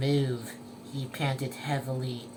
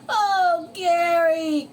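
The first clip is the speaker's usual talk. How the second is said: high, loud anguished cry; SpongeBob crying out; 12 semitones higher, 7 dB louder, slower than usual. Acted. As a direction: wail the name in distress. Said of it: "cried SpongeBob".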